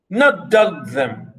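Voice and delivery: ordinary talk, nothing marked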